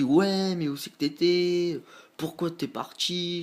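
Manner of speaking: imitating his mother's angry questions; angry mom. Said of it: "elle rentre des courses super vénère".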